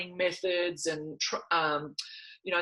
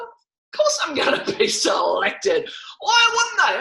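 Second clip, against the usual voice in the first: cocky; 11 semitones higher, 4 dB louder than usual. Retold or imitated. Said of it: "And I've gone".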